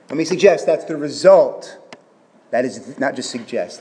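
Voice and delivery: ordinary talk, nothing marked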